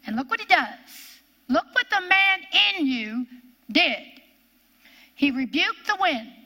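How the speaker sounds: ordinary talk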